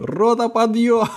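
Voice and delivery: barked army command, high and loud; shouting drill sergeant. Retold or imitated. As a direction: bellow it like a sergeant at dawn. General wakes troops in joke. (кричащий армейский командир)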